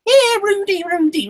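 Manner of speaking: extremely high, loud sing-song call; Buck taunting. Retold or imitated. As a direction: shriek the taunt. Echoing friend's Buck quote.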